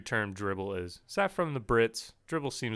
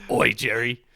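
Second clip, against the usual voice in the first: loud mock British sitcom neighbor; British-accented Kramer; 7 dB louder than usual. Co-host demonstrates whether the accent mash-up works, British Kramer. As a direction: belt out a hammed-up British greeting. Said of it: "put a British spin on Kramer".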